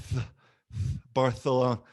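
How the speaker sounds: usual speaking voice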